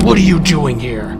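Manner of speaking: booming, accusing demand; loud confrontation. Acted. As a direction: demand it loudly as you burst in on him. Superman confronts mobster at cabin.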